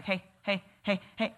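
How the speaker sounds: lightly voiced pestering kid; poking kid. Kid poking an adult for attention, light mock pester. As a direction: nag like a child tugging your sleeve. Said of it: "like a kid who's, like, poking you".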